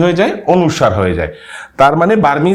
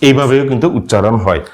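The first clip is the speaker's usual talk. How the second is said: ordinary talk, nothing marked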